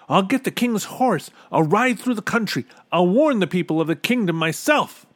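high, loud determined voice; high-pitched heroic boy; urgent resolve. A narrator's reading. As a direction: declare the plan urgently. Bartholomew resolves to warn kingdom.

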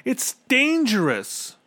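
very high, slow warning; drawn-out high-pitched plea; pleading. A narrator's reading. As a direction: plead with him urgently. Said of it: "pleaded Bartholomew".

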